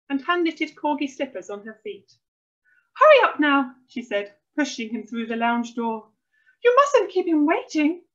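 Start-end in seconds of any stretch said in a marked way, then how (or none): 2.9-3.7 high, loud hurrying; bossy mum. A narrator's reading. Mum hustles son into lounge. 6.6-8.0 high, loud, fussing reproof; bossy mum. A narrator's reading. Mum hustles son to the guest.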